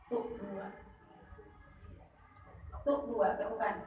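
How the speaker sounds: soft, counting two beats under the breath; counting the pause. Shown as a demonstration. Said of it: "jeda dua ketukan. Dalam hati tuk dua". (menghitung jeda dua ketukan dengan pelan)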